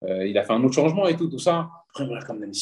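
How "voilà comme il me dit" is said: ordinary talk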